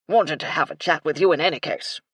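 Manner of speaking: put-on loud, blustery old officer; hearty colonel's voice; bluff colonel. A narrator's reading. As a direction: boom it out like a military gentleman. Colonel's figure voice.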